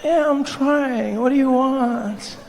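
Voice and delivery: high, very slow weary whine; tired, irritated woman. Acted. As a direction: drag it out, woken and annoyed. Tired woman answering partner in bed.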